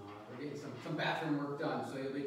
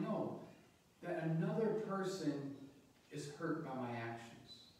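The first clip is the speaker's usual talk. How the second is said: ordinary talk, nothing marked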